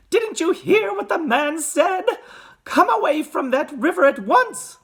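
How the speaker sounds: high-pitched scolding mother; alarmed mother ordering her son; Missus Gloop. A narrator's reading. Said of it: "called out Missus Gloop".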